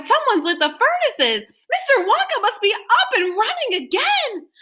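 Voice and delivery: high excited crowd chatter; excited townsfolk. A narrator's reading. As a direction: tell it with rising hope.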